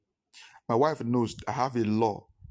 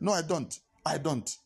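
ordinary talk, nothing marked

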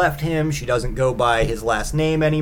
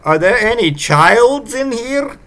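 high, slow, hammed-up foreign visitor voice; creepy Yule lad; 6 semitones higher, 3 dB louder, slower than usual. Yule lad at the open window asks for children, high creepy voice. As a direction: draw the question out high, mock-creepy. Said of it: "and they say".

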